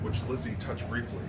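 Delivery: usual speaking voice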